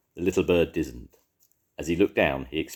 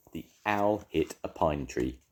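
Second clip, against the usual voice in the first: plain reading voice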